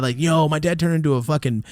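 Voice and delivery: ordinary talk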